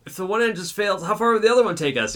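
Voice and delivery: high worried question; nervous passenger. Retold or imitated. Scared passenger asking the drunk about the engine, high and anxious. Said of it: "He's like".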